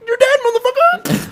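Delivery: very high, loud mock screech; taunting yell. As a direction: yell it at a beaten player over voice chat. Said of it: "just yell at him like".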